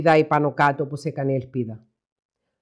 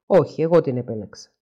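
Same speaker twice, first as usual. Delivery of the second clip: ordinary delivery, nothing marked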